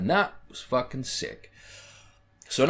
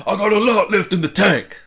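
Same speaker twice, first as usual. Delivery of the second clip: louder, higher hammed-up wrestler boast; a mock Mark Henry taunt, much louder; 9 semitones higher, 10 dB louder than usual. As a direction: boom it out like a heel turning. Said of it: "he's standing over and goes".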